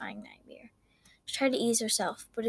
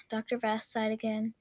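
ordinary delivery, nothing marked

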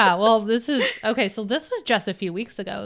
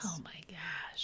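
whispered gasp; 4 semitones lower, 20 dB quieter, slower than usual. Real, not acted. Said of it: "reacting to fear".

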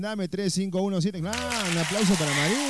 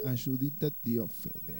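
soft, low and breathy come-on; sensual murmur; 6 semitones lower, 9 dB quieter, much slower than usual. Shown as a demonstration. Radio host showing the caller a sultry whisper. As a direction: purr it like a seductive plea. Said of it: "vamos con más sensualidad".

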